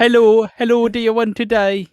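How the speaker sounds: loud, high-pitched silly voice; mock impression. Mocking a co-host's failed Ross Kemp, high silly impression. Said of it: "don't know why he's high pitched".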